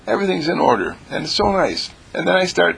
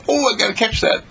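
excited dog voice; 11 semitones higher than usual. Pretend. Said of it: "he sees a bag".

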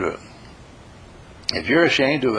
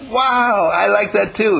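delighted wow; 9 semitones higher, 3 dB louder, slower than usual. Acted. Delighted reply.

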